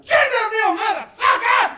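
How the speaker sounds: high, loud mock-triumphant shout; triumphant yell. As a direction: rip the mask off and yell it. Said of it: "I was like".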